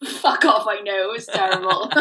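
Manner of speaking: normal talking voice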